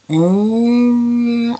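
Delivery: long rising "mm"; imitated scanning hum. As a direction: mimic a slow scan with a hum. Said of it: "and then going".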